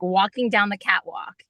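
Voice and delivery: usual speaking voice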